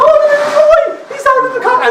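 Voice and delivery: very high laughing coo; cooing nurses. Retold or imitated. Nurses cooing at the escaped baby in a high voice.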